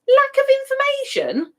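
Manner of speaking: high, loud, rising Lack of information; incredulous. Real, not acted. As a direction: echo it back in disbelief. Incredulous repeat of phrase.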